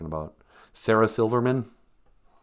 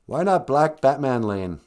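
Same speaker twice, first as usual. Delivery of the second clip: ordinary talk, nothing marked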